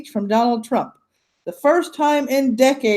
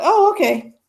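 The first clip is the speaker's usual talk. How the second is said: normal talking voice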